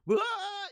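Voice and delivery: put-on high sharp toddler squeal; child's shriek. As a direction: yelp like a toddler who heard the toilet. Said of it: "he just knows".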